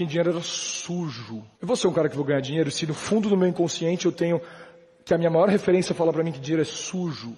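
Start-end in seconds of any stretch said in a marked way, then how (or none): none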